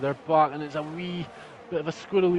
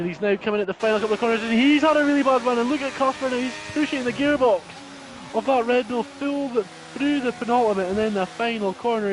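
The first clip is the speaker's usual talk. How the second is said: fast, rising race call; excited commentary; 6 semitones higher than usual. Real, not acted. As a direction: push the pace as the car attacks. Gearbox push in final corners.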